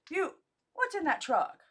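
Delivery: high, loud alarmed question; loud demand. A narrator's reading. Husband confronting wife about the truck, loud. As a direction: demand an answer, startled.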